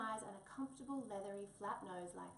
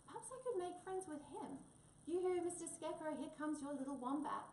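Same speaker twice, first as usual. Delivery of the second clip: cheerful calling out; 6 semitones higher than usual. Acted. Wombat calls out to the scarecrow.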